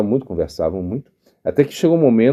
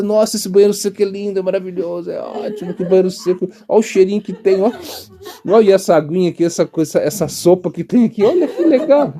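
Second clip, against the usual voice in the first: mock enthusiast; 7 semitones higher than usual. Imitating someone with sukriti marveling.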